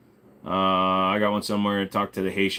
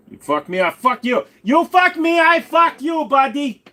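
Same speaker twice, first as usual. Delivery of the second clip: building shout; 7 dB louder than usual. Pretend. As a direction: chant that climbs from normal to a high yelled roar.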